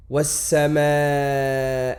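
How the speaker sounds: was-sama très lentement, le s doublé et tenu; étirer le s doublé de was-sama; shadda allongée. Shown as a demonstration. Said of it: "on rajoute une shadda". (shadda bien tenue)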